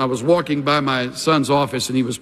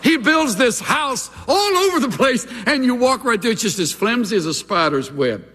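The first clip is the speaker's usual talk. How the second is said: high, driving preacher's voice; high-pitched pulpit exclamation; impassioned preaching; 10 semitones higher than usual. Real, not acted.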